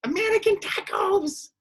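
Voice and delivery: high, slow delighted coo; childish delight. Retold or imitated. Kid told there'll be hot dogs, high delighted coo. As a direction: coo it like a thrilled little kid. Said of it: "And I would be like".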